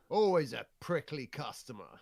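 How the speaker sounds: normal talking voice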